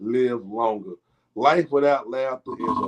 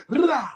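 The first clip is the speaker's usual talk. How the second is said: mock gunshot shout; 10 semitones higher than usual. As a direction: bark it like a burst of gunfire. Again mocking keyboard gunshots.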